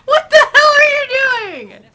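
very high, drawn-out laughing shout; incredulous shriek. Real, not acted. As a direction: scream it, half laughing. Shouting at co-players' antics.

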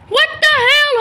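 high, loud startled outburst; a real what-the-hell shriek; shocked. Real, not acted. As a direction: blurt it out in disbelief. Shocked someone copied her outfit.

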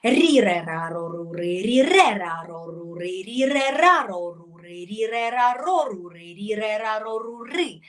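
рі, ре, ра drilled with the stress moving syllable to syllable; ріре ра ро with changing stress; shifting stress. Shown as a demonstration. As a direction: move the stress along рі, ре, ра. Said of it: "змінювати наголоси".

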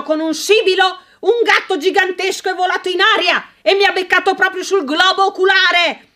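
blustering rage with mocking bursts; furious rant. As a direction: vent outrage, spiking on Insisto. Sir Mortimer ranting at wife.

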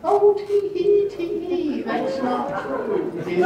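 high, slow, mock-coy tee-hee; tittering sweet lady. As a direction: titter like a scandalized lady, hammed-up. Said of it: "And, and they would say".

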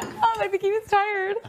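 high, loud mock protest; exaggerated high exclamation; retold loud brush-off. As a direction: retell it as a loud, high-pitched dismissal. Retells brushing off the worry, loudly.

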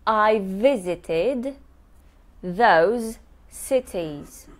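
I visited those cities, slow with the v sounds joined; slow careful teacher model; slow linked demo. Shown as a demonstration. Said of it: "I joined two V's".

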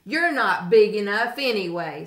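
loud, scornful brush-off; grouchy dismissive. A narrator's reading. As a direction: wave them off loudly. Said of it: "said the grouchy ladybug".

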